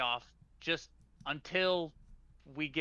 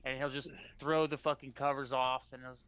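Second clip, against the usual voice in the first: ordinary talk, nothing marked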